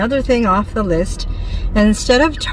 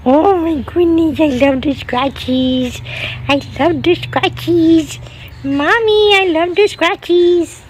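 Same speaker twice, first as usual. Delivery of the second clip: higher, cutesy cat voice speaking for the pet; voicing the cat; 7 semitones higher than usual. Owner voices her cat thanking Mommy, lightly squeaky cat voice. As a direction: play the purring kitty loving its scratches.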